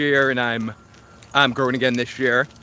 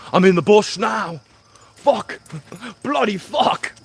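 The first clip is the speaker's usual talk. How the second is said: mock British cursing; 6 semitones higher, slower than usual. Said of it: "right now I'm like".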